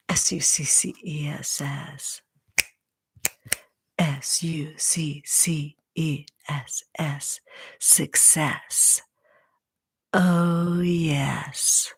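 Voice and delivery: soft whispered chant, spelled out letter by letter; a whispered cheerleader spell-out; ASMR whispered cheer. Pretend. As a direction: breathe the cheer into the mic. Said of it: "purr, whisper".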